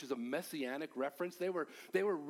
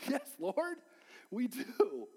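laughing reply; 6 semitones higher, 3 dB louder, slower than usual. Retold or imitated. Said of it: "they reply".

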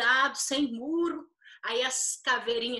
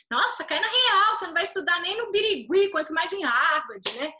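louder, higher mocking retort; scornful put-down; 5 semitones higher, 5 dB louder than usual. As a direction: crush the kid's dream out loud. Mother crushing child's dream. (deboche alto e mais agudo de mãe)

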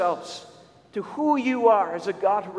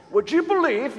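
higher-pitched imitation English accent; Peter Harris's English accent; 6 semitones higher, 3 dB louder than usual. Imitating a colleague bursting into the office with news, English accent. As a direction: put on an excited English accent. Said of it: "saying this in his English accent".

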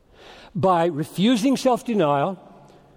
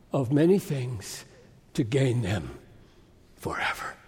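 sinking lower and softer to a near whisper; hushed solemnity; 7 semitones lower, 6 dB quieter than usual. Real, not acted. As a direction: let each phrase fade away gently. Preacher fading for solemn effect.

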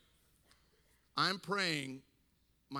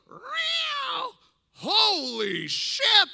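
high, very loud comic shriek; yowl, then slowly blast out the curse; startled outburst; 12 dB louder, much slower than usual. Retold or imitated. Pastor scared at dad's bedside, startled shriek. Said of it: "if you really scare me".

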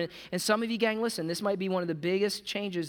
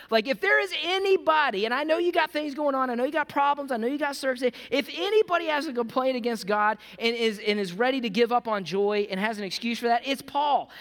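preaching at full force; 4 semitones higher than usual. Acted. Pastor urging the church to think of Paul, preaching at full force. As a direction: push it out to the congregation with rising fire.

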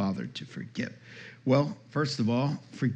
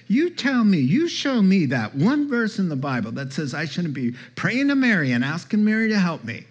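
high, loud, pushy retold challenge; demanding challenge; 6 semitones higher, 4 dB louder than usual. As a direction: mimic her insisting, voice up and pressed. Debbie challenging pastor about Mary.

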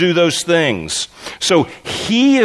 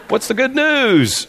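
retold greeting; 8 semitones higher, slower than usual. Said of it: "very casually and said".

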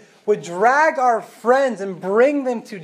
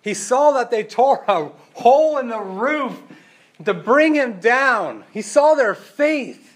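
slow, louder and higher on each phrase; emphatic build; 4 semitones higher, 5 dB louder, slower than usual. Real, not acted. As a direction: drive home every word. Preacher builds to key point.

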